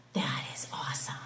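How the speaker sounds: low hushed whisper; a soft whisper of amazement to the congregation; awed whisper. Real, not acted. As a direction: whisper it in awe. Awed aside during reading.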